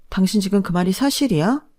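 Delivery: ordinary delivery, nothing marked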